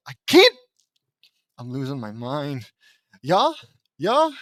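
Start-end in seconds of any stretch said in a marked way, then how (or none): 0.1-0.6 sudden high, loud yelp; exasperated outburst. Real, not acted. Reader giving up mid-line, exasperated yelp. 3.2-4.3 put-on Swedish accent. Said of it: "I don't wanna do a Swedish accent".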